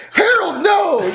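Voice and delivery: shrieking wife. Retold or imitated. Mimicking the wife grabbing her husband's arm, high panicked shriek. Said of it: "She's like".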